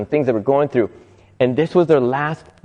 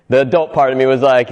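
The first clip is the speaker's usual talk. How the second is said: normal talking voice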